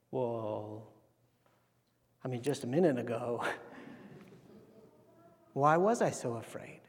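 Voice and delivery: quiet, hesitant sheepish reply; low-key mock bewilderment; acted sheepishness. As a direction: back down softly, a little embarrassed. Disciples' sheepish answer after the calm.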